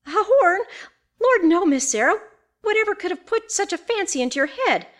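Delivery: high, loud, flustered exclamation; startled denial. A narrator's reading. Housekeeper dismissing Miss Sarah's fancy, startled denial. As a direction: protest in high-pitched alarm.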